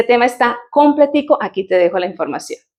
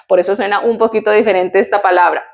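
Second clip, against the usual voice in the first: ordinary talk, nothing marked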